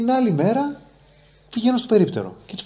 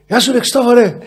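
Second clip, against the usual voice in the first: higher, louder shout of greeting across the street; calling out; 10 semitones higher, 7 dB louder than usual. Retold or imitated. Stranger on the street calling out to the young actor, loud. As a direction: hail him from a distance. Passers-by calling his name.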